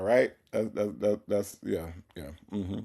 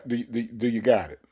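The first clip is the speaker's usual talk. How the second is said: ordinary talk, nothing marked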